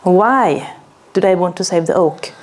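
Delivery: usual speaking voice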